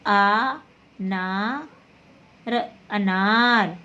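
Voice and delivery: अनार spelled out slowly, syllable by syllable; slow spelling. Shown as a demonstration. Teacher slowly spelling अनार out for the class. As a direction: take अनार apart slowly. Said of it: "लिखते हैं आ".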